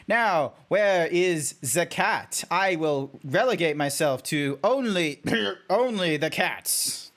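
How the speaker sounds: slow, stilted, over-enunciated creature voice; swooping, stiltedly formal zebra; robotic zebra. Acted. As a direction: drag the words out in mock formality. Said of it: "being the robotic zebra".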